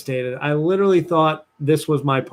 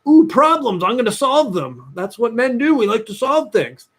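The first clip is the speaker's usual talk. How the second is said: macho problem-solver; 6 semitones higher than usual. Retold or imitated. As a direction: puff up like a know-it-all tough guy. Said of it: "I put on my big, strong man hat and I'm like".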